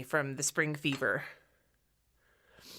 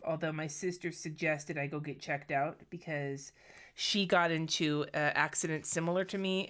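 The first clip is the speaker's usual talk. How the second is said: normal talking voice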